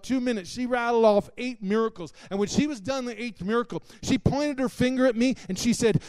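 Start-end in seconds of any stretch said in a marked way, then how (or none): none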